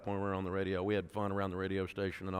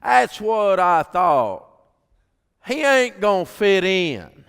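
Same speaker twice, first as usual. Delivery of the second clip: high, loud and very slow, a flat backwoods pronouncement; country wife's verdict; 9 semitones higher, 15 dB louder, much slower than usual. Retold or imitated. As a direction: deliver the blunt verdict like a mic drop. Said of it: "she finally speaks up and she goes".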